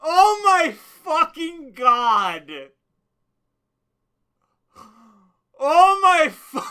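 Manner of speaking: slow, high, breaking with laughter; can't believe what he just heard; drawn-out laughing oh my God; laughing disbelief. Real, not acted. Stunned laughing disbelief at clip.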